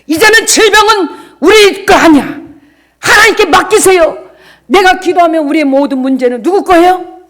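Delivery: loud, high exhortation; full-force preaching. Acted. Pastor assuring believers their pain is God's, loud preaching. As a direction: drive the point home to the pews.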